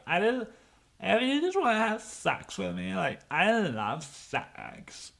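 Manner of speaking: mocking coke fiend. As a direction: stretch it out like a sleazy offer. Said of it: "Some fucking".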